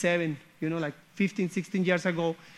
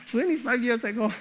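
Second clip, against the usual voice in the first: amused, higher, still chuckling from the joke; laughing; 3 semitones higher, 5 dB quieter than usual. Real, not acted. Speaker joking to listeners about their age, laughing. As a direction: repeat it through a real laugh.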